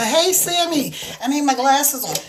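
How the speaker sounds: ordinary delivery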